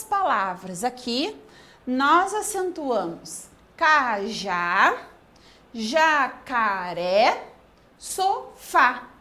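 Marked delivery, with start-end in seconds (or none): 3.8-9.1 "cajá, jacaré, sofá" broken into slow, separate syllables; teacherly syllable-splitting of "cajá, jacaré, sofá", drawn out. Shown as a demonstration. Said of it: "fui falando, dividindo essas palavras em sílabas".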